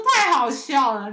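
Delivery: slow, loud amused drawl; cracking up. Retold or imitated. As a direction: stretch it out laughing. Classmate laughing while filming.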